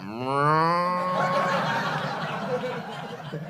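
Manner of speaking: long drawn-out mock drunken groan; wordless drunken drone. Pissed stag party greeting a sober mate, mock wordless drone. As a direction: imitate drunk lads lowing like cattle. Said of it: "No words anymore, just that".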